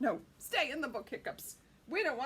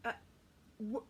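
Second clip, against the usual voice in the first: plain reading voice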